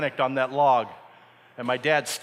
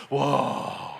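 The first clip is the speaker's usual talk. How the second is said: awed whoa. Acted. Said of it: "All the guys in the boat are like".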